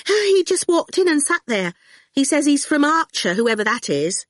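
high, nervous chatter; a flustered student, on edge; jittery Fifi. A narrator's reading. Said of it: "Fifi jittered nervously".

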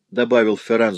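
ordinary delivery, nothing marked

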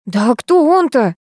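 mildly outraged question; indignant. A narrator's reading. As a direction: demand an answer, outraged. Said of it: "раздались возмущённые голоса".

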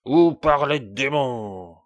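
loud, slow furious threat; angry threat. A narrator's reading. King threatening the dwarf in a rage. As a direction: threaten him with full force. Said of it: "cria le monstre".

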